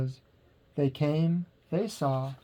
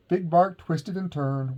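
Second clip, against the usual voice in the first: usual speaking voice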